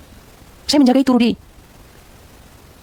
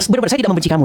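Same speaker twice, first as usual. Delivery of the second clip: ordinary talk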